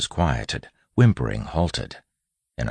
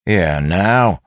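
loud, raised bandit voice; bandit calling out; 6 semitones higher, 8 dB louder than usual. A narrator's reading. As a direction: call roughly to the gang. Second bandit calls out.

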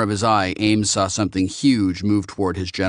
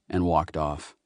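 usual speaking voice

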